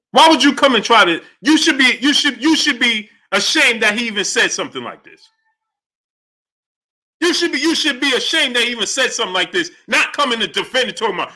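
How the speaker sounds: high-pitched, exasperated rant; indignant scolding. Real, not acted. Host shaming chat for defending him, indignant scolding. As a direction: stumble over the words in outrage.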